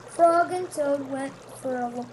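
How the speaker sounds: ordinary delivery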